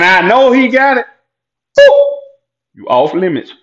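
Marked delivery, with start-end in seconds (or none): 1.8-2.2 high boop. Real, not acted. Sound effect for off limits.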